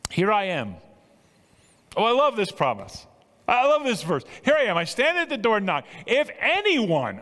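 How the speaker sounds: high, excited proclamation; exultant preaching. Real, not acted. Preacher shares a beloved promise with congregation, exultant. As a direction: exult in the verse, pitch soaring.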